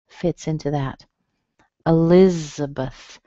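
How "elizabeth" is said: Elizabeth drawn out as uh-LIZ-uh-buth; second-syllable stress. Shown as a demonstration. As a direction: slowly lean on LIZ in Elizabeth, other syllables weak. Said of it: "You can hear a schwa".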